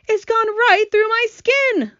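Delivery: high, loud, drawn-out moan; groaning earthworm. A narrator's reading. Earthworm soaked by the storm, high loud groan. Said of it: "the earthworm groaned".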